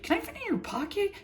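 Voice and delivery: a giddy fan squeaking a question; ecstatic Marcy. Retold or imitated. Marcy asking King Andreas, high, quick and giddy. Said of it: "beyond ecstatic".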